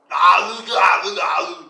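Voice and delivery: loud high monkey-like taunt; taunting shout. Acted. Villain shouting taunts at the player after a short leap, loud and high. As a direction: shout the taunts in his face. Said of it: "and goes".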